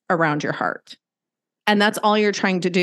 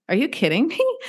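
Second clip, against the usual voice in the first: disbelief; 7 semitones higher than usual. Retold or imitated. His reaction voiced again, higher.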